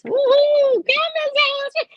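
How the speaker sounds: very high, loud squeal of glee; hammed-up excited whoop; hyped-up cheering. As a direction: cheer to hype up a child. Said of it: "have them dancing".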